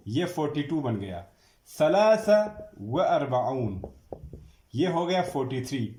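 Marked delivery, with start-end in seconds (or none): 1.8-3.8 teacher calling the next number; called out number. Shown as a demonstration. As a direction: announce the number, then dictate slowly. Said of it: "dictating numbers to class".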